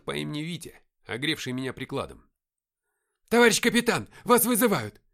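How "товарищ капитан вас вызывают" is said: high, loud yelled report; urgent shout. A narrator's reading. Sergeant bringing the radio to the captain, shouting. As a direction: yell to get the officer's attention. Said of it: "прокричал он".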